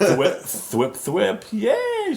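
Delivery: rising from playful sound effects to a very high cheer; mock fangirl glee. Gwenpool showing Miles the web sign, squeaky mock cheer. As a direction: imitate an excited fan squealing. Acts out fan making web-shooter noises.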